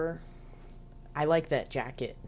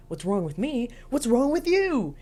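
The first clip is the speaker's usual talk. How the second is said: rising higher and louder; mock creepy anger; 9 semitones higher, 4 dB louder than usual. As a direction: turn it back on her, building. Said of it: "And he's like".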